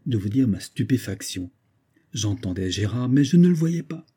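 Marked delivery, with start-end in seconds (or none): none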